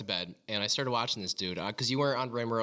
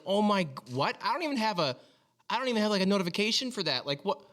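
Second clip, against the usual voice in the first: high-pitched, astonished mock Australian accent; imitating Australian streamer; 10 semitones higher than usual. Retelling the Australian streamer's shocked reaction to a donation, in a high mock Australian accent. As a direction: squeal it out like a stunned Aussie streamer. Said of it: "That's not a very good Australian accent".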